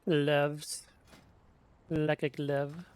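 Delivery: ordinary delivery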